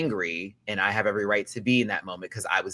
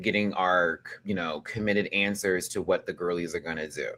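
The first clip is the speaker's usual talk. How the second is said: ordinary talk, nothing marked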